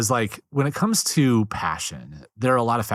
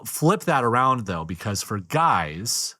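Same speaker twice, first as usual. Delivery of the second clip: normal talking voice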